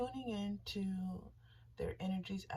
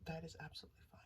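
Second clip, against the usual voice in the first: soft whisper; 4 semitones lower, 7 dB quieter, much faster than usual. Acted. Whispered meditation reassurance.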